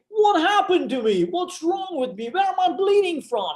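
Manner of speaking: very high-pitched panicked cry, re-enacted; retold screaming. Patient finding himself in a pool of blood, acted panicked screaming. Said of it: "I'm screaming".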